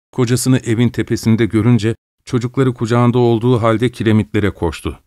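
plain reading voice

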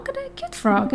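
soft high cooing. Real, not acted. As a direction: coo at a cute animal. Cooing at frog.

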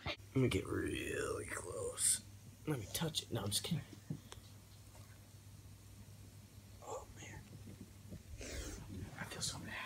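soft, close, breathless whisper. Real, not acted. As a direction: hush it right at the mic. Whispering beside the wound.

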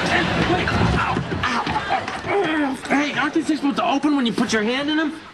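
high, startled and laughing; surprised. Acted. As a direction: caught off guard, laughing. Stuck in elevator doors.

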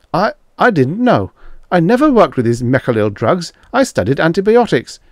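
high, stammering, anxious excuse; flustered protest. A narrator's reading. Grayson excusing himself to his accusers, flustered. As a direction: plead ignorance in a fluster.

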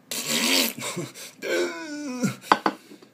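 high loud burst of laughter. Real, not acted. Laughing at his own joke to camera, loud laugh. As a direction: crack up loudly.

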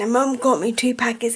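ordinary talk

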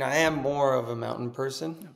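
ordinary delivery

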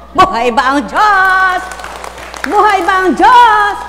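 high, slow, loud call to the crowd; preacher's rallying cry. Real, not acted. Preacher rousing the congregation, loud and slow. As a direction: belt it out to stir the faithful.

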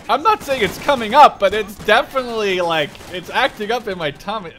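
adrenaline rush. Real, not acted. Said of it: "My adrenaline right now".

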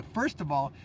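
ordinary talk, nothing marked

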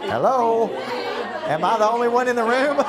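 high, loud shout to the whole room; calling out. Pretend. Speaker jokingly calls out to the chattering room, loud. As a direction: holler for attention over the chatter.

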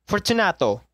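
lightly voiced call into the dark. Narrator calling the name into the walled niche. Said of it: "I called aloud".

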